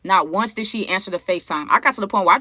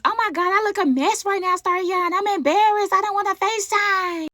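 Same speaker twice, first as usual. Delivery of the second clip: much higher, whiny put-on voice; her forced voice; 11 semitones higher than usual. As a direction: whine it like she's embarrassed. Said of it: "that infamous".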